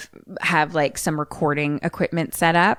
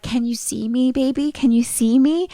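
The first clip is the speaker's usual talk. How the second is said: higher, searching mom voice; mother pleading; 7 semitones higher than usual. Retold or imitated. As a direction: reach out anxiously to an unseen child. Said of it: "Mom's like".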